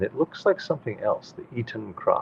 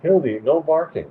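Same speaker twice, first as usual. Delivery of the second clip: scolding the dog; 7 semitones higher, 4 dB louder than usual. Real, not acted.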